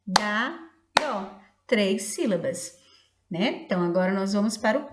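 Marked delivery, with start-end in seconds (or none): 0.1-1.4 Sol, dá, dó as three separate beats; chant-like count, one beat for Sol, dá, dó; syllable by syllable. Shown as a demonstration. Teacher clapping out syllables for children, Sol, dá, dó separated. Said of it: "Pode bater com as mãozinhas assim".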